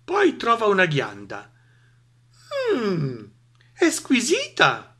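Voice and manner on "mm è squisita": high, slow relish; contented savouring. Acted. Mouse savours an acorn in peace, blissful. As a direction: sigh happily over each delight, drawn out.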